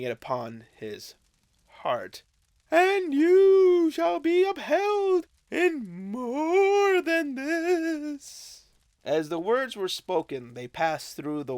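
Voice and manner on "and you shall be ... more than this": high, slow, swelling spirit promise; ghostly spirit voice, louder. Acted. Ghost assuring Scrooge he will be upheld, high and loud. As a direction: lift it up like a ringing vow.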